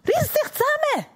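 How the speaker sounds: high-pitched exasperated order; acted scolding, high and insistent; stern command.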